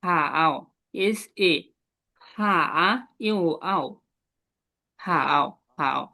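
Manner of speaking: হা আও stretched slowly, sound by sound; slow syllable drill. Shown as a demonstration. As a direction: draw হা আও out slowly. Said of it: "এটা হবে".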